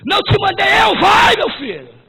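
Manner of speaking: loud shouted divine command; God's command. Acted. God pushing the frozen Joshua forward, loud shouted command. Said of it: "Deus fala assim com ele".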